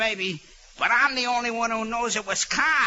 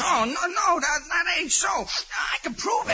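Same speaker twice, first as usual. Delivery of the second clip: panicked protest; 6 semitones higher than usual. Acted. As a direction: plead desperately against the accusation. Accused prisoner protesting in panic.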